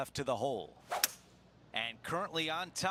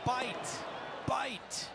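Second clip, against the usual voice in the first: urging the ball; 8 semitones higher than usual. Acted.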